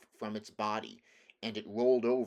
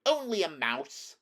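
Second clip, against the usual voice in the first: indignant little mouse, pitched high and louder; 7 semitones higher, 5 dB louder than usual. A narrator's reading. Little mouse protests to the woodman at being belittled, indignant. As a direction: cry it out in high-voiced affront. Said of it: "cried the little animal indignantly".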